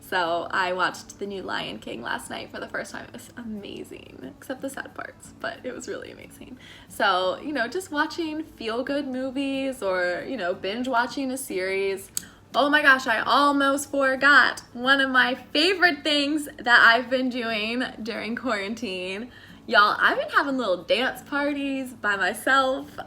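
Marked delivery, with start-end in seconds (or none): 1.4-6.6 soft unvoiced whisper; hushed whisper. Real, not acted. Whispers about Lion King. 12.5-23.0 louder, higher excited burst; sudden thrilled recollection; eager gush. Real, not acted.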